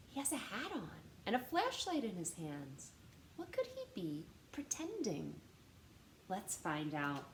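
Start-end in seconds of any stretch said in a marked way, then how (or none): none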